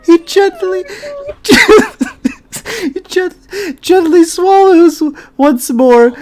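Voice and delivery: fighting laughter to get the words out; laughing through it. Real, not acted. Reading a cringe fanfic aloud, cracking up.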